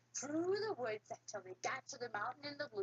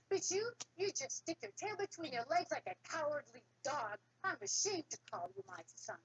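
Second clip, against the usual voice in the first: scornful father; 7 semitones higher than usual. Acted. Father scolds cowardly son.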